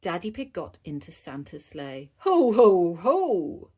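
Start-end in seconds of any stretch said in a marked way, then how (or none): 2.2-3.7 loud Santa-style belly laugh; jolly, loud ho-ho-ho; cheering. Acted. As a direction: boom it out like Santa. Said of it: "he cheered".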